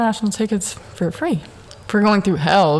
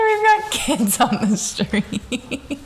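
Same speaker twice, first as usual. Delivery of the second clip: higher, slow, through laughter; higher prim line dissolving into giggles; laughing mock quote; 5 semitones higher, much slower than usual. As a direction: repeat it cracking up at yourself. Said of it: "I really said that".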